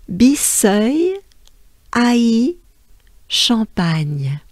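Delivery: "Bisseuil Haï" lifted, "Champagne" falling, pauses between; French name demo. Shown as a demonstration. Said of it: "this long name sounds like this".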